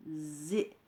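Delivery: Zé said alone, soft and low; isolated syllable. Shown as a demonstration. Teacher isolating the liaison syllable Zé for pupils. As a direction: pull out the new syllable Zé. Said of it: "ça donne une nouvelle syllabe".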